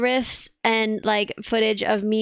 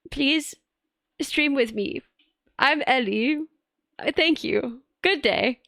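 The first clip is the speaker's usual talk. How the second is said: awkward stiff message. Retold or imitated. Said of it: "and be like".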